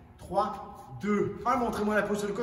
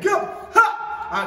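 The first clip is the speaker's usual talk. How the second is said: high, loud karate yell; kiai shout; 13 semitones higher, 10 dB louder than usual. Shown as a demonstration. Karate teacher shouting the kick call for students, loud. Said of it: "avec le kick".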